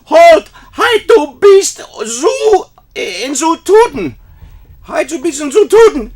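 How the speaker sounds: put-on very loud, high German-accented yell; German shout. German exchange kid screaming at the teacher in German. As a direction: scream halt in German at the top of the lungs. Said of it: "screams out at the top of his lungs".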